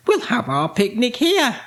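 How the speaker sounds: high-pitched, delighted character voice; cheery witch. A narrator's reading. Winnie choosing a planet for the picnic, high cheery voice. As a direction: lift the voice high, pleased with the find.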